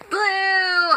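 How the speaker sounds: high, drawn-out exclamation; playful shout. Pretend. Vlogger answering the favorite color question, silly high shout.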